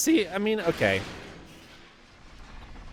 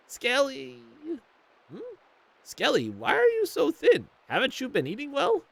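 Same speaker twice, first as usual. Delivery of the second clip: much higher fussing grandma; worried grandma; 12 semitones higher than usual. Acted. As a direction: fret over how thin he is.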